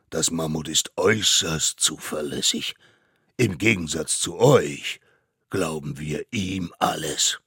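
loud, measured courtroom verdict; stern mammoth judge. Acted. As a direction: put the children in their place with authority. Court contradicts the children. (streng belehrender Richter)

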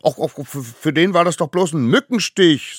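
high, stuttering brush-off; dismissive child. A narrator's reading. Smallest savage belittles the spear wound, cheekily. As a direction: wave it away in a squeaky child voice.

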